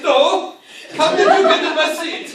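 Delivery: high, loud indignant pilot yelling at the stowaway; shocked pilot shout; outraged pilot. Acted. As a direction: squawk it like a startled pilot. Pilot's outraged reply in skit.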